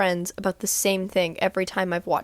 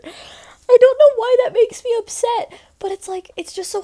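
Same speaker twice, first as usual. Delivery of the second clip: emotional squeal; 14 semitones higher, 6 dB louder than usual. Real, not acted. Emotional about [name].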